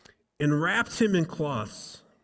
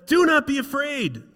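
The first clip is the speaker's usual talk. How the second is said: angel's command; 13 semitones higher, 5 dB louder than usual. Acted. Angel speaks to shepherds.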